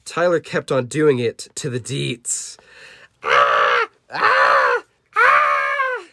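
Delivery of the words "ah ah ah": series of harsh cartoon screeches; acted creature screams; monster screams. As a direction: shriek three times as monsters being hit. Monsters scream under attack.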